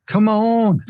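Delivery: very high, loud British accent, hammed up; mock British accent. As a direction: urge the market on in an over-the-top British accent. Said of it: "my British accent comes out".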